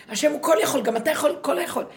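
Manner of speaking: loud, mocking push to be great; mock ego voice, loud and pushy; mock commanding voice. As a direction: bark the ego's demand, hammed up. Mocking ego's all-powerful claim.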